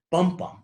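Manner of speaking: first "Bum" strong, second weaker; stressed-unstressed beat. Shown as a demonstration. Lecturer demonstrates meter, "Bum, bum" stressed then unstressed. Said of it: "It's going stressed, unstressed".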